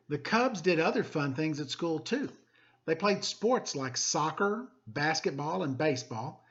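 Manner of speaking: lively, expressive picture-book reading; storybook narration for students; reading to children. Acted. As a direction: read it like story time for the class. Teacher reads picture book aloud.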